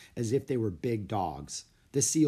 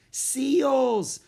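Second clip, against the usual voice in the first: high, loud, drawn-out exclamation; girl shouting with delight; excited cry; 7 dB louder than usual. A narrator's reading. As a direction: cry out at a thrilling sight. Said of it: "cried Annie".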